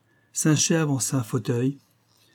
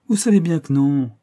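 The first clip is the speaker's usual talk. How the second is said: plus haut et plus fort, piquée au vif; réplique brusque; 5 semitones higher, 7 dB louder than usual. A narrator's reading. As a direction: riposter comme frappée par derrière. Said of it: "en se retournant brusquement". (protestation vive et blessée)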